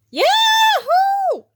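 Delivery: huge high yell of joy. A narrator's reading. SpongeBob yelling in triumph at being chosen, loud high whoop. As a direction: whoop it out at the top of your lungs. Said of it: "yelled SpongeBob".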